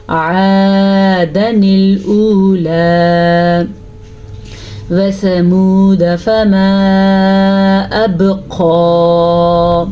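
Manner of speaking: very slow chant, alif after dal silent; Quran recitation. Shown as a demonstration. Teacher reciting the verse again for her class, slow chant. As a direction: recite slowly, stretching every vowel. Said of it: "ہم نے الف ادا نہیں کیا".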